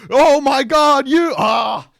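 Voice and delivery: high, loud mock shriek of shock; a big hammed-up gasp-shout. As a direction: scream it like you just found something awful. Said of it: "they're like".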